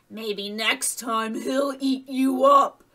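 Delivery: slow, loud menacing wolf voice; boy turning into a wolf, slow and threatening; growing meaner. A narrator's reading. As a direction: turn mean and drag out the threat. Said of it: "his voice grew deeper and meaner".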